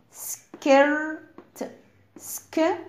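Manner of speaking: "skirt" with stretched "er", then broken into "ske... ert"; sounded-out word. Shown as a demonstration. Teacher giving a like word for IR, "skirt" sounded out slowly. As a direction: split "skirt" slowly into its parts, holding the er. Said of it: "زي الكلمة دي".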